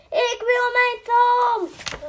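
high, loud and slow wail; demanding shout. A narrator's reading. The princess cries out for her lost tooth, high, loud, slow. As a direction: demand "Ik wil mijn tand!" at the top of your voice. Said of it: "riep de prinses".

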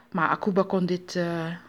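ordinary delivery, nothing marked

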